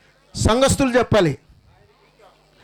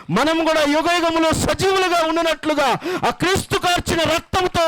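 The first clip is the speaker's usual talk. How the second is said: high, searching preaching questions; preaching at full force; 8 semitones higher than usual. Acted. Pastor calling mourners to repent, fervent preaching. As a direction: press each question home with rising fervour.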